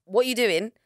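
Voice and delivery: loud, high indignant snap; mock outrage. Rejecting his kiss, loud indignant mock. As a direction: push him off with your voice. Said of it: "I'm like".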